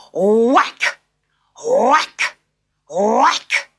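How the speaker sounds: punchy loud sound words; loud whacks. A narrator's reading. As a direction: hit each whack hard.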